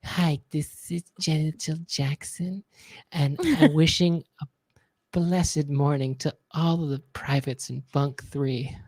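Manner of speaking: very slow, soft, drawn-out greeting. Retold or imitated. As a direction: drag every word softly like a dreamy star. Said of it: "and he goes".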